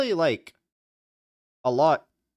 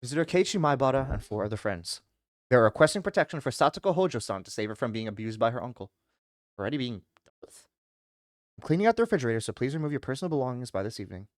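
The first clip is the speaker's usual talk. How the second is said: quieter, even read-aloud of the story text; game text reading; 9 semitones lower, 5 dB quieter than usual. Acted. Streamer reading the visual novel screen, quiet narration. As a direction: read the case notes out, steady and low-key.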